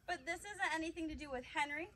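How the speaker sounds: ordinary talk, nothing marked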